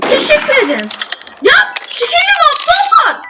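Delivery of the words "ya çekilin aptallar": high annoyed shout; frustrated yell. Real, not acted. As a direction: yell at them to move. Blocked by players in game.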